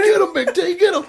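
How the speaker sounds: high, loud cheer through laughter; laughing drunk cheer. Retold or imitated. Friend urging dad into the fight, high laughing cheer. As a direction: cheer him on, cracking up.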